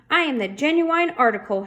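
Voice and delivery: mild proclaimed shout. A narrator's reading. Officer asserting herself over the screaming raccoon, mild shout. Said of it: "shouted Francine".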